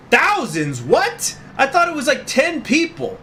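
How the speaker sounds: high, loud disbelief; stunned, voice jumping up; shocked. Real, not acted. Shocked by game's revelation.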